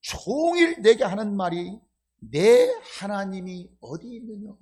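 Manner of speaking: 성경 구절을 비꼬듯 힘주어 읽기; "내 하나님이 어디 있느냐"를 따져 묻듯 비웃으며; 조롱조. Shown as a demonstration. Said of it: "비난하고 조롱하는 말이겠어요?". (비난 섞인 조롱의 말투로)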